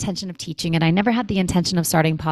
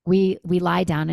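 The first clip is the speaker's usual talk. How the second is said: ordinary talk, nothing marked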